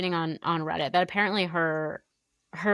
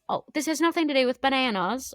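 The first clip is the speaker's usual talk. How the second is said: higher, badly faked accent on nothing; a failed try at Izzy's accent; bad accent attempt; 8 semitones higher than usual. Podcaster admits botching Izzy's accent, a badly faked accent. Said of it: "that's a bad accent".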